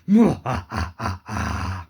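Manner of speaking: strange low villain chuckle; evil laugh. Acted. Possessed engine laughs.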